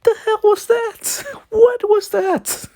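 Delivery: very high falsetto disbelief; incredulous squeal. Real, not acted. Train watcher baffled by a sound on the radio, high-pitched squeal.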